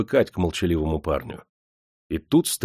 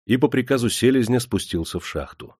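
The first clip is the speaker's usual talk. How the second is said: ordinary delivery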